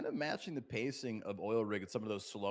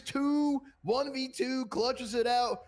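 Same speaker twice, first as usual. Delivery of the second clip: yelling, much higher and louder; excited shout; 8 dB louder, slower than usual. Real, not acted. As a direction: scream the clutch call. Clutch win called live.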